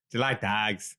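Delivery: imitated Irish Traveller accent; Brad Pitt in Snatch. Quoting a film to friends, put-on Irish Traveller accent. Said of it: "doing Brad Pitt in Snatch".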